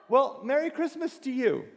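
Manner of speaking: high, pointedly polite Merry Christmas; forced cheer. Retold or imitated. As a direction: rise above with a tight smile. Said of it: "So I go".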